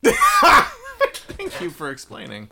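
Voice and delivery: high, slow, loud mock gratitude; sarcastic thanks. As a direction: drag out a sarcastic thank-you. Sarcastic reply to accent confession.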